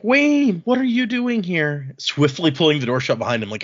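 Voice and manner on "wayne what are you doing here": high, drawn-out exasperated disbelief; mock dismay. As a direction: act appalled at an unwelcome visitor. Acted dismay at unwelcome visitor.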